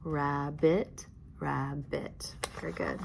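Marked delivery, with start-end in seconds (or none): 0.0-1.1 "rabbit" as rab, bit; tapped-out syllables. Shown as a demonstration. Teacher tapping out "rabbit" for pupils, syllable by syllable. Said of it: "and then tap". 1.4-2.4 softer "rabbit" in two beats; tapped-out syllables. Shown as a demonstration. Teacher repeating the tapped "rabbit", softly, syllable by syllable. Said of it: "and then tap".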